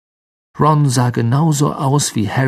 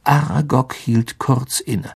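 plain reading voice

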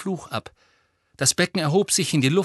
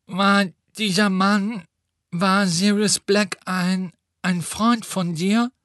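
Neville voice; 5 semitones higher than usual. A narrator's reading.

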